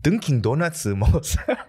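fast imitation of a Daegu guy's Korean, rising at the end; Daegu accent. Imitating a man from Daegu for his friend, fast Daegu accent. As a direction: rattle it off like a Daegu guy would. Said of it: "I'm gonna try to imitate something that he said".

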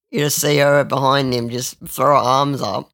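ordinary talk, nothing marked

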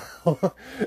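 a short squeal of a laugh; high burst of laughter. Real, not acted. Brother laughing at snake bite story, high laugh. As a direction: break into a quick high laugh.

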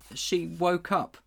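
high, slow, miserable admission; a boy confessing in misery; wretched. Acted. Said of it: "said Diggory wretchedly".

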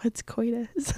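unvoiced, hushed question; whispering. Real, not acted. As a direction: ask it in an embarrassed whisper. Shy embarrassed question.

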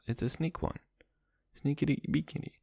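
usual speaking voice

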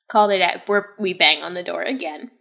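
normal talking voice

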